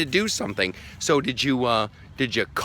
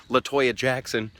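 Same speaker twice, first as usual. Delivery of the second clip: ordinary talk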